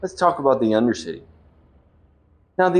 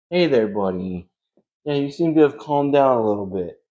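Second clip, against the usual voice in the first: usual speaking voice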